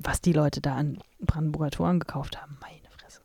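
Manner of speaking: hushed whisper. Real, not acted. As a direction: whisper it in disbelief. Exasperated aside about tourists.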